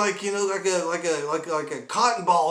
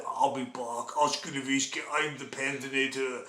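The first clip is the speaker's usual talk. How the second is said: lower, quieter mock Terminator threat; Terminator dog; 3 semitones lower, 6 dB quieter than usual. Dog plotting revenge as a mock Terminator, lower. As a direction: growl it like a movie cyborg. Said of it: "She's probably saying to herself".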